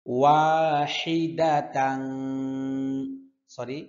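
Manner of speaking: slow melodic chanted recitation; Quran recitation. Shown as a demonstration. Teacher chanting verse slowly for students. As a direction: chant each word drawn out for students to repeat.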